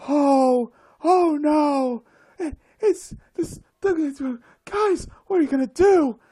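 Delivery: high, loud, fast alarmed whining; mocking panicky crowd. Mocking the other crowd's panic, high loud mock alarm. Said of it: "the other crowd that says".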